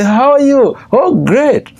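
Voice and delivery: high, fake-happy office greeting; put-on cheer. Office worker hiding unhappiness from visitors, high put-on cheer. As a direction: greet with a mock cheerful facade. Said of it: "A smile that people put on their face".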